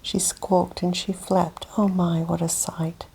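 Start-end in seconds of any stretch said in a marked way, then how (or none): none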